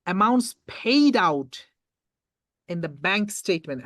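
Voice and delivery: slow, deliberate reading, each word drawn out; read very slowly. Shown as a demonstration. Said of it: "I'm gonna read this question very slowly again for you".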